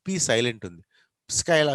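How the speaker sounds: normal talking voice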